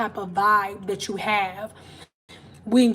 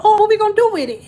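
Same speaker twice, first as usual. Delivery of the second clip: much higher, louder, fast panic; flustered angels; 11 semitones higher, 8 dB louder, much faster than usual. Retold or imitated. Angels in heaven panicking, much higher, louder and fast. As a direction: blurt it out like a fluster. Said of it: "they're like".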